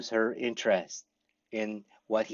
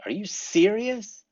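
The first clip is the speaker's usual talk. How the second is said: higher, slow are you serious; amazed question; 9 semitones higher, slower than usual. Shown as a demonstration. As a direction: stretch it out in disbelief. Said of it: "means same as".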